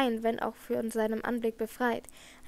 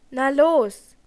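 very loud, short urging; impatient shout; 11 dB louder than usual. A narrator's reading. Raoul spurs Christine to continue, impatient shout. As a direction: shout her onward.

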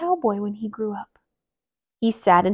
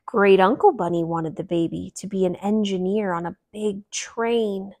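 ordinary delivery

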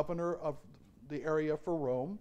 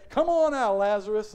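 calling out; 2 semitones higher, 8 dB louder than usual. Acted. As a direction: call him out with full voice. Jesus calling Lazarus from the tomb.

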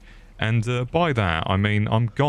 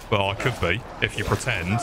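normal talking voice

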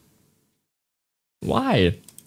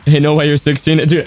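ordinary delivery

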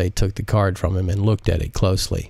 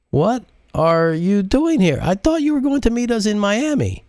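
stunned question; 13 semitones higher than usual. Acted. Modise stunned to see her husband, asking in high disbelief. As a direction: ask in disbelief. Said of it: "she managed to speak first".

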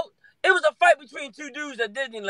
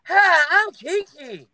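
slow, higher sweet intro as Keke; imitating Keke; 6 semitones higher, much slower than usual. Retelling how Keke introduced herself, slow and high. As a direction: mimic her drawn-out greeting, pitched up. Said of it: "she was like".

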